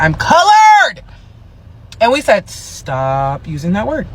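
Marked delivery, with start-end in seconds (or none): 0.0-1.0 high, loud hammed-up declaration; mock proclamation. Imitating [name] insisting on her label, hammed-up loud proclamation. Mocks [name]'s insistent self-description. 2.5-4.1 low, soft, slow retold plea; measured warning. Community cautioning [name] about a word, soft slow warning.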